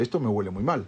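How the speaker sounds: king wrinkling his nose, soft and low; disgusted aside. Retold or imitated. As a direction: grumble it under your breath. King smells Haman.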